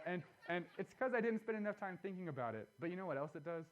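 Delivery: usual speaking voice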